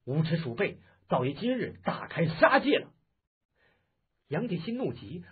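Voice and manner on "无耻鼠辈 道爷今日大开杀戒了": loud threat climbing high; fierce shout. Acted. Taoist to the two men he despises, a fierce threatening shout. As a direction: thunder out a furious warning, building to the end. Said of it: "那道人喝道".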